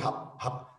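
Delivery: "rápido" cut to "hap", ending swallowed; swallowed fast speech. Retold or imitated. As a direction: imitate a fast talker clipping "rápido". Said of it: "ela fala muito rápido".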